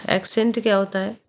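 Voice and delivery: ordinary talk, nothing marked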